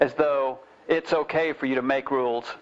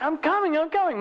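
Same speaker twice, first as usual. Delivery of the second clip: high-pitched mock yell; retold yelling; 11 semitones higher than usual. Mother yelling back to children from the phone, imitated. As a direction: imitate an exasperated call, pitched way up. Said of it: "she kept yelling to them".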